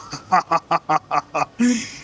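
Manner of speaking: a mocking laugh that climbs; amused rising chuckle. Real, not acted. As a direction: laugh at the absurdity.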